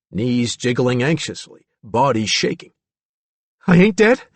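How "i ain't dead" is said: high, loud, bewildered question; startled character voice; frightened disbelief. A narrator's reading. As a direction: ask it like a scared boy. Billy shaking, disoriented.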